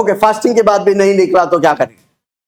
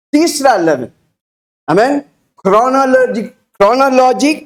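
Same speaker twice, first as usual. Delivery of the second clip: ordinary delivery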